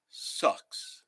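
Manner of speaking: a breathy whisper of disgust; whispered sucks. Real, not acted. Griping to viewers about hospital coffee, whispered. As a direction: whisper the word under your breath.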